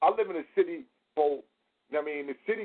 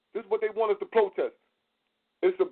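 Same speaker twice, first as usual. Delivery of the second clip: ordinary talk, nothing marked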